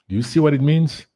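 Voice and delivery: ordinary talk, nothing marked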